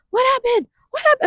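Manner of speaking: high-pitched imitation of mom asking. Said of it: "my mom would be like".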